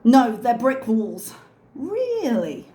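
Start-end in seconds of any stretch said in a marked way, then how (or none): 1.8-2.7 drawn-out high "Really?" falling at the end; incredulous, falling "Really?"; mock disbelief. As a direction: raise REALLY, then let it drop. Mock disbelief at brick-wall claim.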